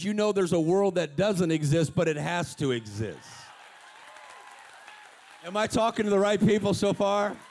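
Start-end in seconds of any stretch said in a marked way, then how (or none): 5.4-7.4 loud, high rallying shout; shouting to crowd. Real, not acted. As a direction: rouse the congregation.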